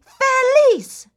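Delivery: very high, loud happy cry; happy shout. Shown as a demonstration. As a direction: belt it out with joy. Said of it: "Now let's get happy".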